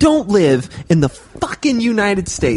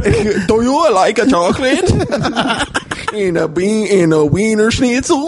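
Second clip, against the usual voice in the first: put-on silly German accent broken by laughs; laughing mock German accent; 3 semitones higher than usual. Teasing foreign listeners, in a laughing mock German accent. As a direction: ham up a bad German accent while giggling. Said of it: "attempt a German accent".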